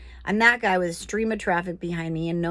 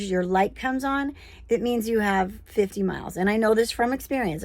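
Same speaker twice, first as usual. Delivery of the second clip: usual speaking voice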